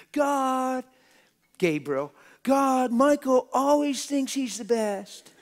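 high, whiny voice telling on Michael; tattling angel. Pretend. Preacher mocking a jealous angel complaining to God, high whine. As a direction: whine like a child telling on a brother.

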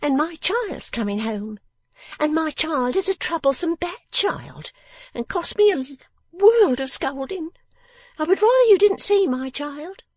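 high, loud complaining character voice; put-upon Miss Wren. Acted. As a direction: grumble like a scolding little housekeeper, pitched high.

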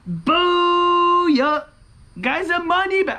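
high celebratory cry; excited whoop. Real, not acted. Player won the whole ticket, excited whoop. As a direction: crow it high to your viewers.

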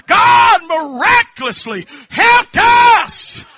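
very high, slow, ringing shout; preacher's triumphant peak shout; ecstatic shout. Real, not acted. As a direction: cry it out to the rafters.

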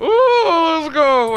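high, loud whoop; excited cheer. Real, not acted. Player hyping up the team mid-match, excited cheer. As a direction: whoop it out, hyped up.

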